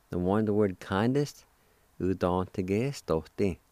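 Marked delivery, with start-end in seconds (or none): none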